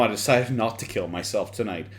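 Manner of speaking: usual speaking voice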